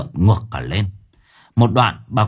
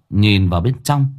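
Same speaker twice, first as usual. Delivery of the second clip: ordinary delivery, nothing marked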